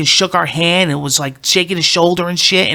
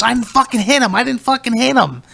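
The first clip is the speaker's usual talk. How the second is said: higher-pitched protest, imitating an accused dad; retold indignant denial; 7 semitones higher than usual. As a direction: protest it like a wrongly blamed dad, without shouting. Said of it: "Like that".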